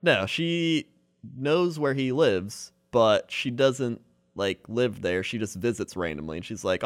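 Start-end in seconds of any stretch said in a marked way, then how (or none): none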